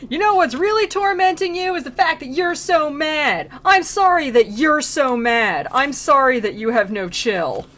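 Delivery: high, loud mock rant; hammed-up scolding that eases a little at the end; Virgil yelling. Guide berating a tormented soul, comic exasperated yelling. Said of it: "He starts yelling at those souls".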